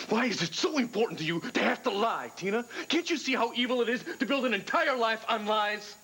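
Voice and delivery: high, pressing, furious questions; angry accusation. Acted. Husband condemning Tina's lies, high furious accusation. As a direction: hurl the accusation, pitch climbing.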